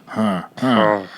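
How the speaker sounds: short, soft low moans. Shown as a demonstration. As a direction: demonstrate a quiet warm-up moan. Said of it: "like, with a moan".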